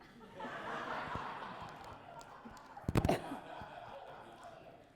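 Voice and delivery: high airy burst of laughter; laughing at own joke. Real, not acted. Preacher laughing at her own grandpa joke, airy laughter. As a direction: break into a breathy laugh at the punchline.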